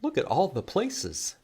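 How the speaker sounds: lively storybook read-aloud; reading to children. Acted. Librarian reading a picture book to children, lively. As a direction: read it like story time for little ones.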